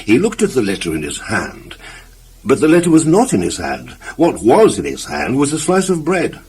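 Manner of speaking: ordinary delivery, nothing marked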